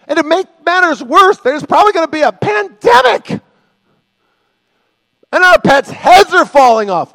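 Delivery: high, loud, hammed-up panic; exaggerated, overwrought complaining; mock doom-laden griping. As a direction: pile up the disasters in a frantic shout. Mock complaining about the world.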